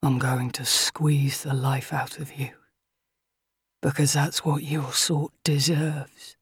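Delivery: low, hushed masked villain; muffled menace. A narrator's reading. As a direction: threaten in a hollow, whispery undertone. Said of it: "His voice is muffled as he speaks".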